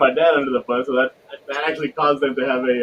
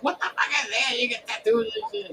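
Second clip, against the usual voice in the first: higher, softer shocked scold; imitated mom outrage; 9 semitones higher, 6 dB quieter than usual. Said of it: "She's like".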